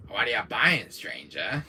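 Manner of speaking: low shady trader ending on a drop; low, soft salesman impression; The Merchant. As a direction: greet a customer in a low sly voice. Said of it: "Now I sound like Albert Wesker doing The Merchant".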